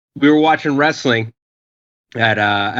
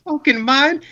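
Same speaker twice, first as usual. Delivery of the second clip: incredulous yell. Retold or imitated. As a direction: yell at him in disbelief. Speaker shocked at friend's surrender.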